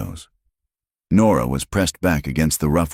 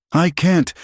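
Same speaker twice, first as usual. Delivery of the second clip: high, louder, near tears; choked out; 13 semitones higher, 4 dB louder than usual. Acted. Terrified Nora choking out words, near tears. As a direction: force it out through panic. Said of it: "she choked out".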